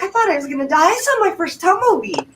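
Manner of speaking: high, breathless retold panic; rambling panic. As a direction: gush it out like you nearly died. Retelling panic to gas attendant.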